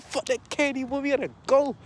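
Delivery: high, frantic shout; urgent yell. Retold or imitated. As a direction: hurry them along, yelling. Dante yelling while fleeing.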